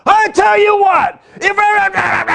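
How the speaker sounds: high, loud, drawn-out mock tirade; hammed-up shouting rant; passionate outburst. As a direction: yell it like someone losing their temper. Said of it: "we say it with passion".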